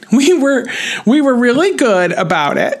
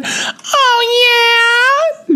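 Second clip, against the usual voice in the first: excited shout. Retold or imitated. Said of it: "Christine said".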